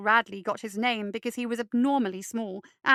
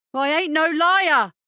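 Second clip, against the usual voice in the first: louder, slowed snap of denial; snapped; 5 semitones higher, 9 dB louder, slower than usual. Acted. Boyle snapping at Radley in front of Sunny, slow loud snap. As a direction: snap back without looking up. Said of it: "Boyle snapped".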